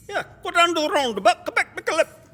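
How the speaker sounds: loud, high-pitched rapid-fire burble of directions; imitated local man.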